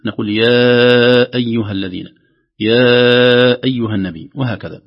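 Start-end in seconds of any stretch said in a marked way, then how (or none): 0.3-2.1 long-held "يا" in "يا أيها الذين"; madd demonstration. Shown as a demonstration. Teacher shows reciters the four-count madd, long-held "يا". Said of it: "لكنه مد جائز منفصل". 2.6-4.3 long-held "يا" in "يا أيها النبي"; madd demonstration. Shown as a demonstration. Teacher gives second example of madd, long-held "يا". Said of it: "هذا المد يمد بمقدار أربع حركات".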